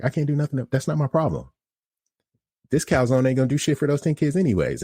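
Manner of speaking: high, exasperated comeback to customer; retold pissed-off retort. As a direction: act out losing patience with the customer. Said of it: "I'm starting to get pissed off now".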